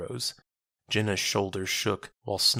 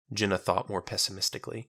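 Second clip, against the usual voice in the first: usual speaking voice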